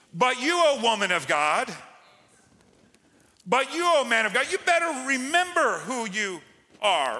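high, loud preacher's cry; exhorting. Acted. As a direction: lift it and ring it out.

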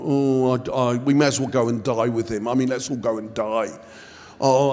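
low, droning mock moan; gloomy moaning pessimist. Preacher imitating a doubting disciple, hammed-up gloomy moaning. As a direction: groan it out as a hopeless depressive. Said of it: "He was the manic depressive of the group".

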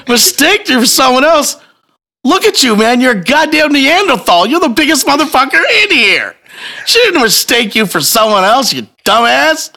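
high-pitched, worked-up retelling of a rant; incredulous berating. Retold or imitated. Husband mocking the groper's excuse, incredulous. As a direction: tear into him, pitch way up.

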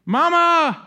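put-on child's high, loud cry of Mama; child calling. Preacher voicing a frustrated kid calling for mom, high and loud. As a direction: call out like a little kid who needs help.

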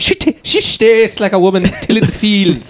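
mock Irish "shtastes", high and amused; hammed-up Irish accent breaking into a laugh; imitated Irish accent. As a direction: put on the Irish YouTuber's slushy "sh". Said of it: "he says that things shtaste instead of taste".

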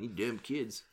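Josh Bricky impression; lightly voiced grumpy old man. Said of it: "That was a Josh Bricky impression".

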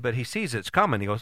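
ordinary talk, nothing marked

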